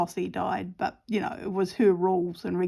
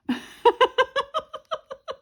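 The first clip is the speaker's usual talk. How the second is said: high, helpless giggling; laughing; 6 dB louder than usual. Real, not acted. Retelling a favourite comedy skit, laughing hard. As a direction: crack up at the skit.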